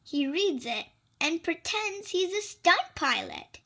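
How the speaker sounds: ordinary delivery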